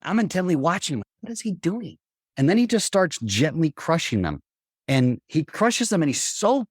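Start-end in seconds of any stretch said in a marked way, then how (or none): 1.2-2.0 soft, higher-pitched wondering aloud; hushed retold question to himself; puzzled wondering. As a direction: wonder quietly at what you see. Retold puzzled thought while watching.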